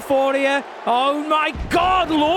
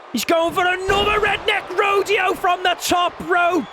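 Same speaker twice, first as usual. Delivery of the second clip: excited call; 7 semitones higher than usual. Acted. Big move called out.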